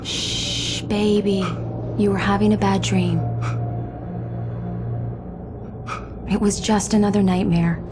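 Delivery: soothing. Acted. Wife soothes him after nightmare.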